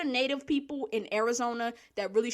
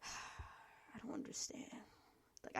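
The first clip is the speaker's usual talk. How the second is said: whisper; 21 dB quieter, slower than usual. Real, not acted. Exasperated sigh after political rant.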